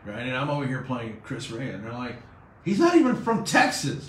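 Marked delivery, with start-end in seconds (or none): 2.6-4.1 high, loud mock protest; punchy mock indignation, pitched up; indignant listeners. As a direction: imitate an outraged caller objecting. Mimicking objecting radio listeners.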